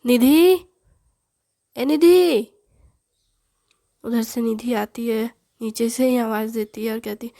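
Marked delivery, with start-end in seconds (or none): 0.0-2.5 loud call across the courtyard; calling Nidhi down below. A narrator's reading. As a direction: shout her name from upstairs. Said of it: "आवाज़ देते हुए कहती है".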